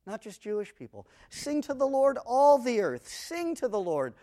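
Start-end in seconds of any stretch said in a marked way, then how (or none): 1.3-3.1 grand proclamation. Acted. Proclaiming the psalm again. 3.1-4.1 psalm declamation. Acted.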